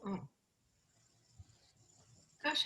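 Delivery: ordinary talk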